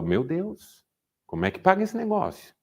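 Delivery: a startled husband thinking of the debt; sudden worried "how do we pay this?"; alarmed. Retold or imitated. Panic at losing income.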